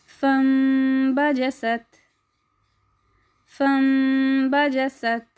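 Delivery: फम्बजसत with the small म hummed through the nose, slowly; nasal meem recitation. Shown as a demonstration. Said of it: "छोटे से मीम की आवाज़ को अपनी नाक में". (म को नाक में लेकर धीमी क़िराअत)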